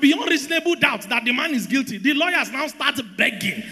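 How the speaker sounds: high-pitched, emphatic sermon delivery; preacher driving the point home; full-force preaching. Real, not acted. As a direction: preach it with urgent force to the congregation.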